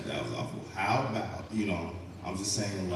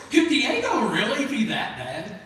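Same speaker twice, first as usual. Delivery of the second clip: laughing; 14 semitones higher, 6 dB louder than usual. Real, not acted. Laughing through the punchline.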